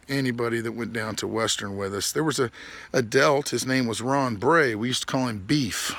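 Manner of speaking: normal talking voice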